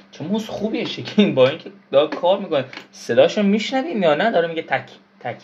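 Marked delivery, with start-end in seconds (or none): none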